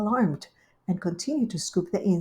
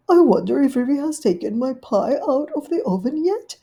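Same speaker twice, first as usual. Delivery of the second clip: higher, louder dog-lady voice; fretful little dog waiting; Duchess's voice; 7 semitones higher, 6 dB louder than usual. Acted. Duchess waiting in the lane, higher fretful voice.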